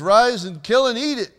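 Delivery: the voice orders Peter to eat, loud; heavenly command. Acted. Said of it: "this voice says".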